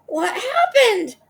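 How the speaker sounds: high, loud, slow shocked scream. Acted. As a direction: scream out in horror. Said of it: "screamed Missus Twit".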